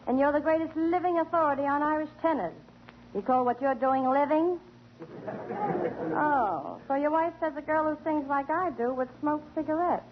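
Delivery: usual speaking voice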